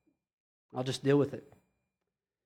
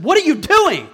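raised, high-pitched scolding demand; mock outburst, louder and higher, as the visiting apostle; indignant confrontation; 6 semitones higher, 6 dB louder than usual. Paul walks in and confronts the church, indignant outburst.

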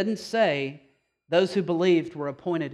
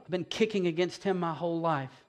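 normal talking voice

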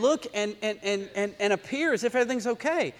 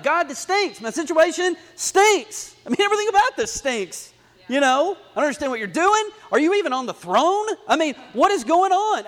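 raised, higher, frustrated cry at God; angry prayer; 5 semitones higher, 5 dB louder than usual. Retold or imitated. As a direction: rant at God like David. David's angry prayer acted out.

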